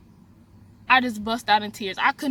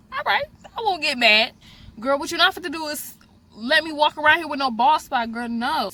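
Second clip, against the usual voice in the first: sassy defiance; 4 semitones higher than usual. Real, not acted. Defiant retort about bald spot.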